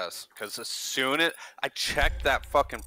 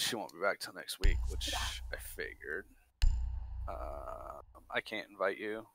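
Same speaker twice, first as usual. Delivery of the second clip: whispered aside; 4 semitones lower, 8 dB quieter than usual. Real, not acted. Muttering aside.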